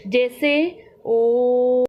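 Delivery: ओ held out very long and slow; drawn-out teaching demo of ओ; prolonged vowel. Shown as a demonstration. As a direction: stretch the ओ as far as a pluta vowel goes. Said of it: "सबसे अधिक समय लगता है".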